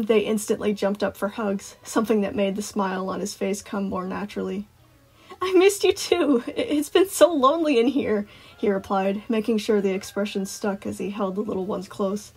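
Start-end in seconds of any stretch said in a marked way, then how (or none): 5.4-8.3 high, animated cartoon-attendant voice; Sun, gushing. A narrator's reading. Sun hugging returning children, high gushing reply. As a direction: gush in a raised, eager voice.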